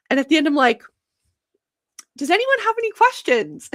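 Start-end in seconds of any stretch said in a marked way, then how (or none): 2.2-3.7 eager question. Retold or imitated. Retold eager end of presentation.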